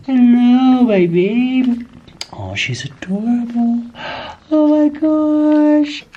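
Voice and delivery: high, drawn-out cooing; adoring pet talk. Real, not acted. Owner says hello to her adorable kitten, cooing.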